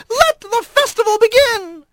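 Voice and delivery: high, thin, carrying lizard call; thin reedy voice. A narrator's reading. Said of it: "his reedy voice carrying".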